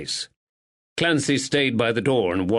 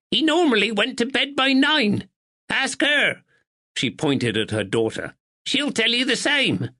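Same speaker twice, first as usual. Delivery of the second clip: put-on sharp old woman; 12 semitones higher than usual. A narrator's reading. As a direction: snap it out like a sharp sparrow.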